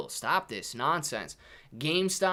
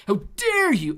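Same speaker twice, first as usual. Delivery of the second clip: high, loud mock indignation; mock outrage; 12 semitones higher, 7 dB louder than usual. Streamer defending Aldi to a viewer, mock outrage. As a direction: act scandalized, pitched up and loud.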